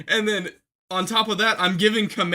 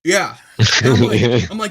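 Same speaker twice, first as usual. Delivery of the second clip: normal talking voice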